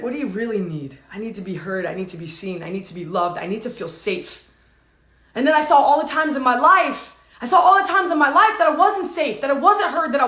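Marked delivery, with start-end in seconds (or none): 0.0-4.5 low, hushed inner dialogue; soft, low, bare admission; vulnerable plea. Retold or imitated. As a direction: voice quiet needs softly, pitched down. Dialogue with the wounded part.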